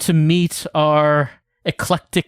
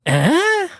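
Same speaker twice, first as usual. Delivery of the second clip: startled eh. Acted. Startled character.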